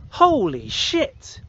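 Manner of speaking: slow, drawn-out stunned exclamation; stunned holy shit. Retold or imitated. Retelling his shock at the video, slow stunned gasp. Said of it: "And I was like".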